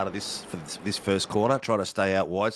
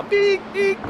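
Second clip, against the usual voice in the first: high-pitched imitated phone beeps; mock phone notification beeps; phone beeping; 5 dB louder than usual. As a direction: squeak out a phone alert, much higher and louder. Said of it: "It's just gonna go".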